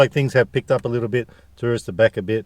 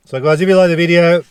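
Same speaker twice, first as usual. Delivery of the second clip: loud, high outro call; excited; 8 semitones higher, 8 dB louder than usual. Real, not acted. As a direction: pump up the sign-off. Hyped vlog outro.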